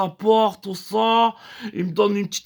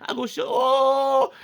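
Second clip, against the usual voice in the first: mock moan; 5 semitones higher, much slower than usual. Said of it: "ça fait".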